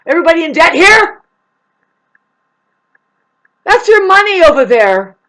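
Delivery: very high, loud taunting call; taunting the judge about debt; mock confrontation. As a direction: call out the judge in a sneering yell. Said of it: "go over there and say".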